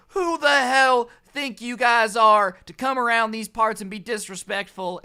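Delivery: imitating an irate Karen; mock Karen rant. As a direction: ham up an outraged local. Imitating the angry woman.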